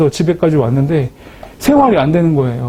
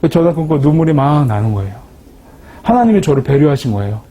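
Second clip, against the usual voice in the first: normal talking voice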